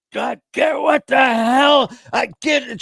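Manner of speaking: high, loud mock scream, slowed; imitating mom screaming. Son retelling his mom yelling at the dogs, a high mock scream. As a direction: shriek it like a frazzled mom. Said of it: "She's screaming".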